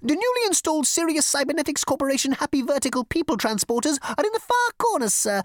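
a snooty bug brushing off a caller; petulant insect receptionist. Acted. Insect directs.

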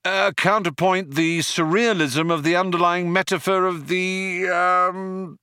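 high, tentative bluff trailing into a long um; hazarding, then floundering. A narrator's reading. Ford leaping to Arthur's rescue, floundering. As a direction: pitch it up and run out of ideas. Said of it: "hazarding ... he floundered too".

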